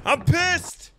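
shouting "pissed" right after dying in the game; angry shout. Real, not acted. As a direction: blow up at the death. Just got killed in game.

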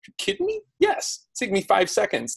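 eager yes to a small favour; happy surprise. Retold or imitated. Influencer asked for a testimonial quote, high pleased surprise. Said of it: "And they'll be like".

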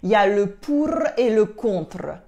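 exaggerated trilled R in "pour" and "contre"; hammed-up tongue-tip R's on "pour" and "contre"; overdone rolled R's. Shown as a demonstration. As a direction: roll the R in "pour" and "contre" hard on purpose. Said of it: "I'm overdoing this on purpose".

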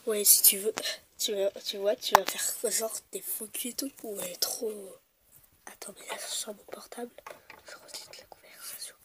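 hushed conspiratorial whisper. Real, not acted. Kids plotting in secret, whispering. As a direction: whisper it so nobody hears.

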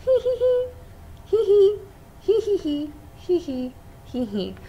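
high tittering that fades away; sneaky giggles. Acted. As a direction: giggle like a hiding child. Hidden eggs giggling.

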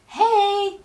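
high, loud call stretched out; shouting. A narrator's reading. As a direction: call out to someone far away. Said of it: "he shouted".

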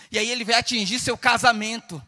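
mounting preacher's list, rising to loud, high shouts; preaching, building to a shout. Real, not acted. Preacher warning what the devil will attack, building to a shout. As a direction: build each phrase until you are shouting.

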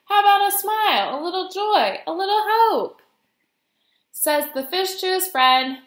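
animated singsong rhyme; reading to children. A narrator's reading. Guest reader telling of squid scolding mopey fish, lively storytime read. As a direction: play up each line for young listeners.

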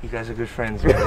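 muffled behind surgical mask; through a face mask. Real, not acted. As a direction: mouth covered by cloth. Seen 3: Speaker's blue surgical mask covers mouth and nose while talking.

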